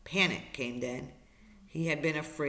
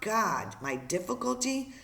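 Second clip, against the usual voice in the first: higher, exasperated disbelief; incredulous; 6 semitones higher than usual. Acted. Brian reacts to being asked his difficulty, incredulous. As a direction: question it as if it were absurd.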